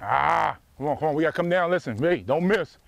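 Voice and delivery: ordinary talk, nothing marked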